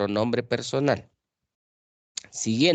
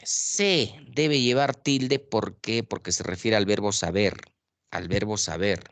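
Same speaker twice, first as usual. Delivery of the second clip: usual speaking voice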